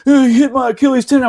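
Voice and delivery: high, loudish, hurried whine; whining crybaby. Retold or imitated. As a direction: imitate a crybaby accusing someone. Imitating the crying recruit's complaint.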